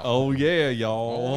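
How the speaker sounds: loud Texan "Oh yeah, y'all"; Texas accent. Pretend. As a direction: belt it like a Texas cowboy. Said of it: "in a Texas accent".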